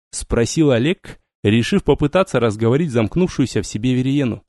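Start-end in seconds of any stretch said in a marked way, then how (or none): none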